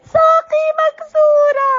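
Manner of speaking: high, loud crying shout; distraught wail. Acted. Jood learns her leg is broken, loud tearful wailing.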